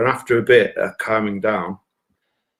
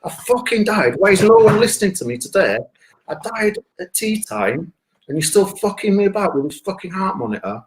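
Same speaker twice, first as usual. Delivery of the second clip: triumphant; 9 semitones higher than usual. Retold or imitated. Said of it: "I'm saying to the nurses".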